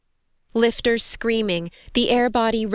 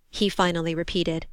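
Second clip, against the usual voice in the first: plain reading voice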